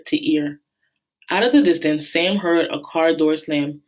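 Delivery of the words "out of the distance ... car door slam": ordinary delivery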